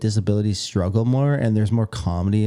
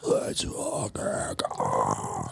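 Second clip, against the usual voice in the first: lower, breathy scream without voice; whisper yelling; 13 semitones lower, 9 dB quieter, much slower than usual. Shown as a demonstration. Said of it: "I learned about whisper screaming".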